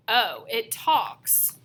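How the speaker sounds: drawn out slowly, lightly voiced boy; slow deadpan kid voice. Small boy marvelling at the big kid, said slowly. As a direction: let each word hang, mock amazed. Freak's first words to narrator.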